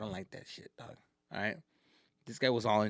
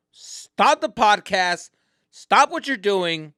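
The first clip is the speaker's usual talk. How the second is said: slow, loud orders; commanding; 6 semitones higher, 9 dB louder, slower than usual. Real, not acted. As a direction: command the listeners to drop everything. Ordering listeners to stop.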